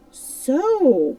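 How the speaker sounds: sharp, high, loud "So!"; startled cry. A narrator's reading. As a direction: jolt of alarmed surprise. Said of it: "cried Silver".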